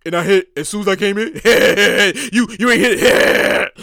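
low, laughing mock brag; teasing brother. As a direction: gloat like the lucky brother rubbing it in. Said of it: "I'm never gonna hear the end".

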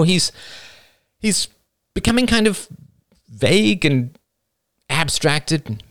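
high-pitched, halting, anxious son; Hamish, troubled and reluctant. Acted. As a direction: confide haltingly, then let the details tumble out.

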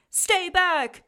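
loud high warning shout. Acted. Bruce shouts at Cyril to stay back. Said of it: "shouted Bruce".

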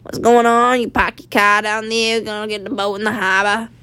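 lightly put-on Maine accent, casual and chummy; a hint of a Mainer greeting a buddy at the harbor. Friendly local playing a Mainer greeting a guy at the harbor, Maine accent. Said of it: "hardcore Mainer accents".

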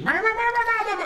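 high mock meows; cat meowing. Man imitating his cat's meows at night, high-pitched. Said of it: "he's like".

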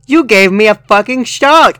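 loud, high hammed-up yell; startled mock outrage; angry shout. A narrator's reading. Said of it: "I shouted angrily".

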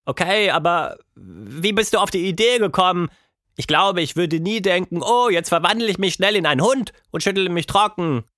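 hohe Mädchenstimme, neugierig; Tilda, neugierig. A narrator's reading. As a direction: kindlich nachbohren. Tilda fragt. (Tilda bohrt neugierig nach)

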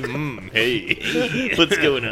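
slow seductive greeting; sultry pickup line. Pretend. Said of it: "same exact pickup moves".

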